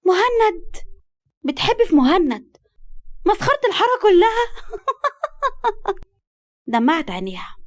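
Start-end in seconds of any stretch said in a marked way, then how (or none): 0.1-6.7 high, incredulous teasing breaking into laughter; mock her crush, laughing; astonished laughter. A narrator's reading. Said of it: "وقالت بذهول وضحك". (ذهول وضحك)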